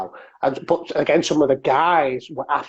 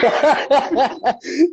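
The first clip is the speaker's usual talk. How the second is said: high burst of laughter; 9 semitones higher than usual. Real, not acted. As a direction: crack up at the joke. Host laughs at her joke.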